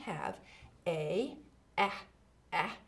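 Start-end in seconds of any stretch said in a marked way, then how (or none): 1.8-2.8 short "ah" sound repeated; sounding out words. Shown as a demonstration. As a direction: say "ah" as a clipped vowel sound. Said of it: "I'll tell you the sound".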